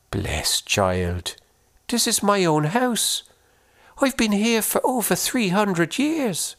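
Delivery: put-on high, lilting old-world woman; curious and kindly; Una's voice. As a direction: ghost woman asking the boy about his corpse. Una voiced.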